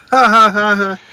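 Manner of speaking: loud, high mocking ha-ha-ha; mock laugh. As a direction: fake pointing-and-laughing taunt. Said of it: "Point at him and laugh".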